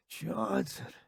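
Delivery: soft, hopeless murmur of dismay; sorrowful mutter. A narrator's reading. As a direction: say the name with no hope. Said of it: "he mutters in sorrow".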